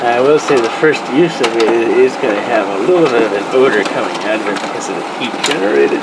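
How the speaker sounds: speaking up over a whirring printer. Real, not acted. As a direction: project loudly over machine noise. Talking over running printer noise.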